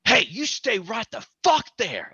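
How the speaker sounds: threatening shout. Retold or imitated. Angry neighbor ordering him to stay put, mock yell. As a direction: bark an order at a stranger. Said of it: "he goes".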